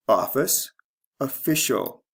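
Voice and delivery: OFfice rising, then ofFIcial falling; shifting stress. Shown as a demonstration. Teacher reading noun then adjective, stress moving to second syllable in official. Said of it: "The stress changes from first to second syllable".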